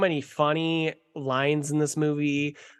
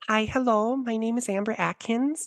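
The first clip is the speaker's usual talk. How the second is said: put-on higher-pitched Minnesotan pageant girl; put-on Minnesota accent, pitched up; 7 semitones higher than usual. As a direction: imitate a perky Minnesota contestant introducing herself. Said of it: "Her Minnesota accent is so good".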